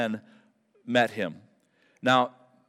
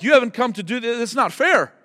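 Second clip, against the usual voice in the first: demons pleading; 9 semitones higher, 4 dB louder than usual. Acted. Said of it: "They're like".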